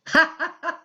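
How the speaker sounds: high, short mad giggle. A narrator's reading. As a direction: let a crazed little laugh slip. Laughing after the tub line.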